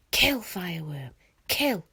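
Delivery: loud bully chant, falling hard; yelling. Acted. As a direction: bark it at the dragon like a bully. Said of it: "broke off from yelling".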